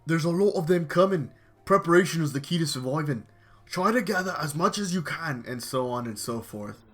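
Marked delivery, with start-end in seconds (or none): 0.1-5.4 louder, higher mock survivalist; imitating the Prepper. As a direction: lecture like a bossy survivalist. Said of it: "like, saying".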